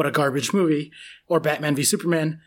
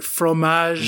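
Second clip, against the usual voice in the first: Fromage with a French r and nasal end; French accent. Shown as a demonstration. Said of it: "Can you say it the French way?".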